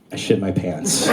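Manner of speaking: low, soft admission; quiet sheepish confession; meek confession. Retold or imitated. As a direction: mumble it sheepishly. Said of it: "And I was like".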